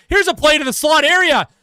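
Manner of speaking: excited call. Real, not acted. Commentator sees a play to the slot, excited call. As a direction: jump up in pitch as danger builds. Calling a scoring chance.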